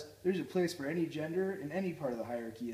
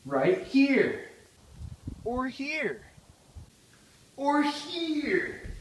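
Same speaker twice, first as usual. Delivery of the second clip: louder and much higher each time; excited; 9 semitones higher, 8 dB louder, much slower than usual. Acted. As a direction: build excitement with each here. Host pointing places, excited.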